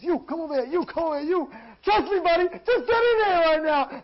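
high, loud frantic commands; urgent rounding-up. Acted. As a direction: call people over as if there's no time. Crazed man rounds people up.

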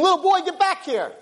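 high-pitched frantic call; acted scream. King summoning the little boy back, screaming. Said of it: "starts screaming".

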